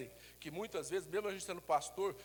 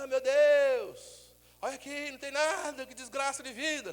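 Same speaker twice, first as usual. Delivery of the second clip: mock lament; 8 semitones higher, 7 dB louder, slower than usual. Shown as a demonstration.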